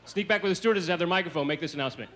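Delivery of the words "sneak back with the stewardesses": normal talking voice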